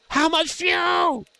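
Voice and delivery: very high, drawn-out call; high-pitched call. Real, not acted. Streamer asks the gang about fuel, high drawn-out call. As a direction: stretch it out high in a put-on voice.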